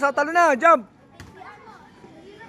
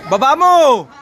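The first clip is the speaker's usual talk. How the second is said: shouting; 4 semitones higher, 4 dB louder than usual. Real, not acted. Coach calling out drill commands.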